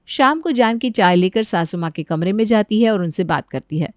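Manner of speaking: ordinary delivery, nothing marked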